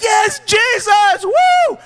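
high, loud shouted praise; mock hyped shout of joy; hyped-up cheer. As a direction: whoop it out like a fan at a rally. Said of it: "So they're hyped up".

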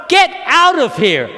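high, loud commanding shout; acted outraged order; righteous indignation. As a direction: drive them out with your voice. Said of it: "Righteous indignation".

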